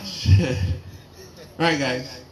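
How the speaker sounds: ordinary delivery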